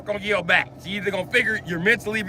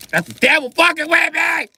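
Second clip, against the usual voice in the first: higher, louder, fed up; exasperated outburst; 9 semitones higher, 4 dB louder than usual. Acted. Fed up, yelling at the devil tormenting him. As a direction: blow up at the devil. Repeats line as loud outburst.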